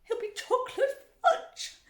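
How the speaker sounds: high, slow, loud screech; screaming. A narrator's reading. Mother fearing for her son, screaming. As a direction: screech it, drawing the words out. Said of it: "screamed Missus Gloop".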